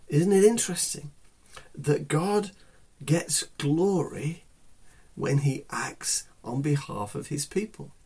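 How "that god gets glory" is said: usual speaking voice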